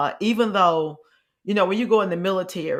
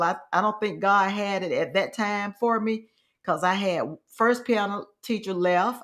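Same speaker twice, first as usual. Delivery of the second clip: normal talking voice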